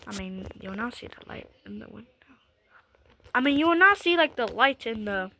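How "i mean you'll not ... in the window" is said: whispering. Real, not acted. Whispered correction after outburst.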